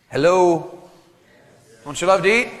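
ordinary talk

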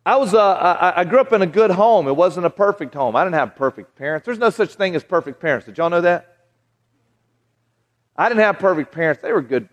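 normal talking voice

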